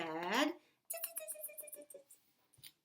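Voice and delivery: quick repeated tongue clicks; chattering monkey tsks; monkeys' tsk-tsk. A narrator's reading. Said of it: "back at him and said".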